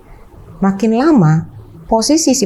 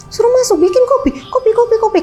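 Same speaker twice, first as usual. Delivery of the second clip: much higher, dazed repeating; confused father. Retold or imitated. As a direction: repeat it high like someone bewildered. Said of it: "kayak orang bingung gitu".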